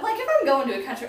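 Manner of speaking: ordinary delivery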